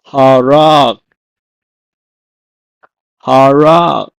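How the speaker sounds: harak loud, in two long syllables; Hebrew pronunciation. Shown as a demonstration. Teacher gives the Hebrew for slay, loud clear harak. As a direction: project "harak" clearly, then repeat. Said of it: "is pronounced as".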